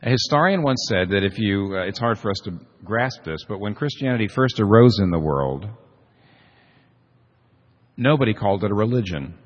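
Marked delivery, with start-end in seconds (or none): none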